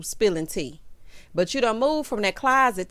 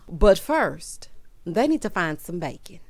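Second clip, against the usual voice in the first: ordinary delivery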